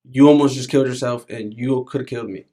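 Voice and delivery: ordinary talk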